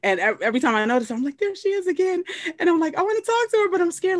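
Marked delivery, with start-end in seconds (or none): 1.4-2.2 nervous excitement. Retold or imitated. Self retold nervous starstruck. 3.0-4.2 nervous excitement. Retold or imitated. Retold self nervous fan.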